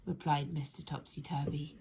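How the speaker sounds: ordinary delivery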